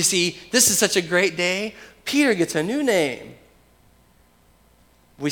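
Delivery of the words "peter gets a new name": raised pitch on a lively proclamation; emphatic announcement. Real, not acted. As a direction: announce the new name with emphasis. First, emphatic take of repeated line.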